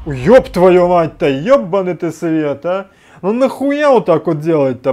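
loud, drawn-out startled swearing; real jolt of shock, swearing at full volume; startled cursing. Real, not acted. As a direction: curse loudly after a jump scare. Startled by a game jump scare.

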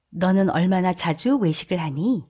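usual speaking voice

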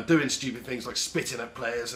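ordinary delivery

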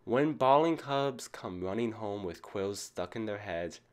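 plain reading voice

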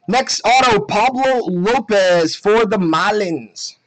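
loud, high card-reveal call; excited announcement. Real, not acted. Announces an autograph card pull.

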